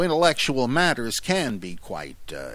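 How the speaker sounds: ordinary delivery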